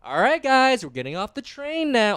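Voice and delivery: high, singsong rounding-up voice; mock parental announcement; parent herding everyone. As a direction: corral the group like a parent. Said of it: "I had to be the parent".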